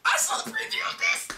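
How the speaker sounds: very high squeaky mock fan voice; excited viewer. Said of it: "they're gonna be like".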